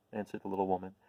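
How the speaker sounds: plain reading voice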